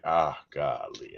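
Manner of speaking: low, soft, slow sigh of words; mild retold grief. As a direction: murmur it as the painful memory returns. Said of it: "I'm reliving my mom's death".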